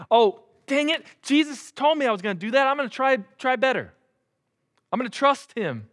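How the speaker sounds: high-pitched, hurried resolve; hammed-up self-reproach. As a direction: scold yourself in a high, urgent voice. Imagined resolve of Peter, acted.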